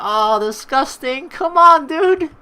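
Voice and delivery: high, loud groan of disgust; disgusted outburst. Real, not acted. Player reacting to the trucker's gross remark, loud disgusted outburst. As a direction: cry out at him in disgust.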